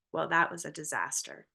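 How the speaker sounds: mild mopey sadness voice; sadness character. Acted. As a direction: deflate it gloomily. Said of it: "her sadness character says".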